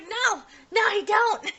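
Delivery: high-pitched, loud flustered no; embarrassed high yelp of denial. Retold or imitated. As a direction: protest too hard, squeaking it out. Toru flustered denying a crush.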